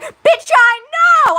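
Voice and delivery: high, loud, slow snap-back; a cat bristling at being teased; sassy retort. Retold or imitated. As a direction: fire it back with cheeky attitude. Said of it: "She's like".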